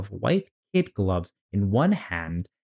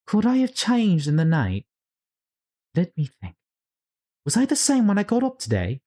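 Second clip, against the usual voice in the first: put-on much higher, puzzled little-girl voice; bewildered Alice; 8 semitones higher than usual. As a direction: wonder aloud who she is, high and lost. Alice wondering aloud.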